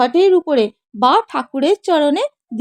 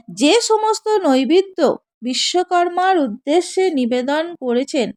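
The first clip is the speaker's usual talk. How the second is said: ordinary delivery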